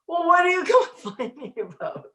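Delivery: high, quick, laughing reproach; playful telling-off, breaking into laughter; mock scolding inner voice. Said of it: "the voice would go".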